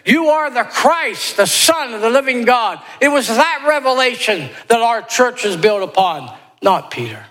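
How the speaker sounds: full-voiced preacher's climax; fervent preaching. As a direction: proclaim it with rising fervor.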